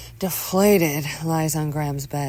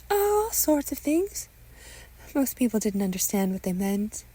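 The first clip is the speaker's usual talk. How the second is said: high-pitched mother reminiscing; doting mother; 9 semitones higher than usual. Acted. Mother tells son he is her work of art, high doting voice. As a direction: play an adoring mother, pitched well up.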